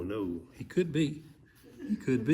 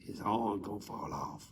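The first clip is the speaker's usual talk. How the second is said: low, hushed secret; whisper; 3 semitones lower, 6 dB quieter than usual. Real, not acted. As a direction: whisper it into an ear. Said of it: "let me swift it to you".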